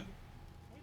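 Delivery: soft whispered hey to the cat. Real, not acted. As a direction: breathe it to the cat.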